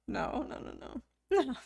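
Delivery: normal talking voice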